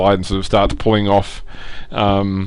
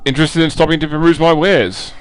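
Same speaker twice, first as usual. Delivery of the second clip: lightly voiced, higher-pitched trader; merchant's sales pitch; 7 semitones higher than usual. Merchant invites travellers to browse, lightly acted higher voice. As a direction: pitch it up a little as a merchant offering wares. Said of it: "Trells basically goes to sort of yell a".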